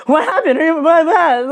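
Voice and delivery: high, loud urgent questioning; alarmed friend. Retold or imitated. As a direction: imitate a friend rushing over in a panic. Acts friend rushing over, alarmed.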